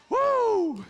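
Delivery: high loud excited whoop; preacher's whoop. Real, not acted. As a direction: let out a joyful holler.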